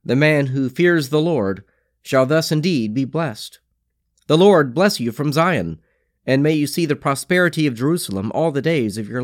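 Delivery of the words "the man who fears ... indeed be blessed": ordinary talk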